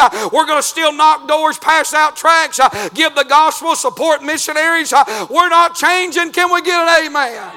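loud, high revival preaching; rousing, fiery sermon climax; preaching at full force. Acted. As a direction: call it out to the whole church. Preaching to the congregation.

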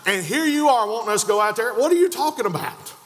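indignant protest rising in pitch; exasperated back-talk, pitch jumping on the last question; with an attitude. Acted. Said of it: "saying it with an attitude".

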